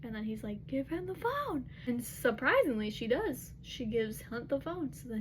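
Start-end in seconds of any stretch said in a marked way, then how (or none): 0.7-1.7 high-pitched insistent demand; retold urgent order. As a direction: imitate an urgent command, pitched well up. Acted order in retold scene.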